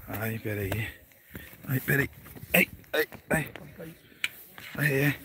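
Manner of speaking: strained little gasps on each step; panting a bit while climbing; climbing stairs. Real, not acted. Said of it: "Tô subindo uma escada aqui".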